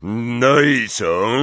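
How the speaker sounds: slow, drawn-out grumpy question; surly giant's question; gruff grunt. A narrator's reading. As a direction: grunt it grudgingly. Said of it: "burknął Hagrid".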